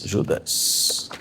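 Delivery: whispered Judas, soft and breathy; softly whispered repetition of Judas. Real, not acted. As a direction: drop to a whisper on the name Judas. Repeats the name in a whisper.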